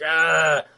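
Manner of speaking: mock monster howl; creature death scream. Creature explodes.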